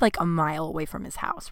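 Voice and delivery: ordinary talk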